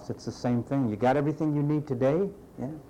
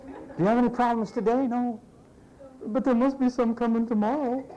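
mock exasperation; 8 semitones higher than usual. Mocking problem-seeking listener.

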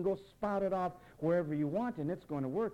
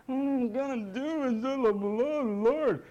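high, loud whining plea to the Lord; hammed-up whimpering prayer; mock whine; 8 semitones higher, 6 dB louder, slower than usual. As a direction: imitate a whiny pray-er drawing out "Lord". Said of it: "you whine in the name of the Lord".

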